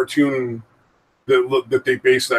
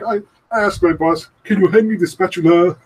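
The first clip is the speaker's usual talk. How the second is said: higher-pitched mock meme accent; Ugandan Knuckles accent; 6 semitones higher, 4 dB louder than usual. Telling how he asked his boss, accidental Knuckles accent. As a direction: imitate someone slipping into Knuckles accent. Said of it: "he would accidentally do the fucking accent".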